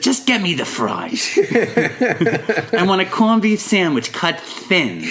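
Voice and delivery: hammed-up Long Island accent, insistent; Long Island woman. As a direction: imitate a demanding Long Island deli order. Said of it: "And she said".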